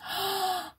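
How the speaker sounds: sharp high gasp of realisation; sudden gasp of discovery. Acted. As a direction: gasp as it clicks. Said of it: "She tried to work out".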